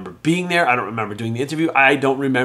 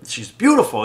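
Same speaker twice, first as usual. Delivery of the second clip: ordinary delivery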